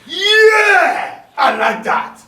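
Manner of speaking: long high yell, then loud approval; exuberant shout. Acted. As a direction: holler it out with delight.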